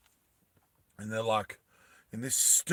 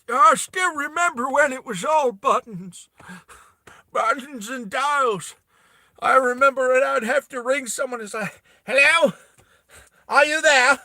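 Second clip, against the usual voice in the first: put-on high, loud old-timer on an early telephone; mock old-timer; 9 semitones higher, 7 dB louder than usual.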